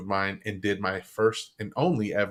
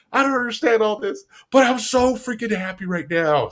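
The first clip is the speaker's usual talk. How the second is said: overwhelmed joy; 10 semitones higher than usual. Retold or imitated. Overwhelmed after the talk.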